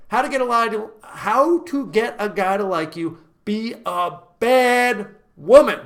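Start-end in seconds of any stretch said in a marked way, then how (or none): 3.4-5.8 slow, louder, every word stressed; emphatic slogan. Real, not acted. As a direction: land the slogan hard. Summing up the lesson.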